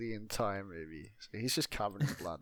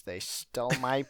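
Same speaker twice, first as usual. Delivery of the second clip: mock snapping; 5 semitones higher, 7 dB louder than usual. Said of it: "this lady just snaps".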